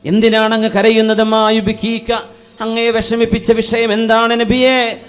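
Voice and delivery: high, pleading sermon voice; impassioned preaching. Retold or imitated. Bilal begging the Prophet to explain his tears, impassioned. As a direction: plead loudly as a devoted companion.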